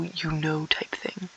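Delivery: slow, soft whisper. Acted. To viewers, a slow knowing whisper. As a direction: stretch it out in a hush.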